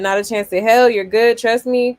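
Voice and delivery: mock loud quote. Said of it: "he said".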